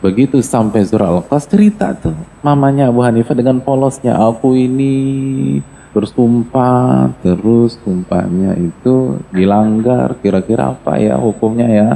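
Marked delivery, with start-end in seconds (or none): 4.2-11.9 put-on slow, naive old mother asking; a simple mother naively asks the scholar about her oath; innocent mother. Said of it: "dengan gaya polos seorang, eh, ibu".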